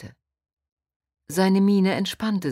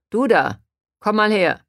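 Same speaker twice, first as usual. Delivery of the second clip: loud, higher, barsh command; gruff policeman; 5 semitones higher, 8 dB louder than usual. A narrator's reading. Policeman stopping Han Li from behind, gruff and loud. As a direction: bark a gruff order at someone. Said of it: "eine barsche Stimme hinter ihr".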